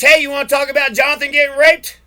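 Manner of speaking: loud, jeering call across the porch; mocking taunt. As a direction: shout it out to mock him.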